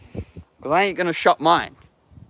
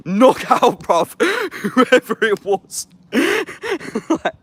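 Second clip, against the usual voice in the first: laughing; 11 semitones higher, 5 dB louder, much slower than usual. Real, not acted. Laughing at his own story.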